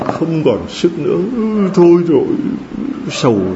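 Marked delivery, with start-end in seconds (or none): 1.4-2.3 a high, mock-defeated sigh of despair; giving up. Preacher mimicking a despairing mourner. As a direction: act out someone collapsing in grief.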